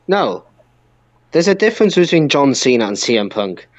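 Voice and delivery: normal talking voice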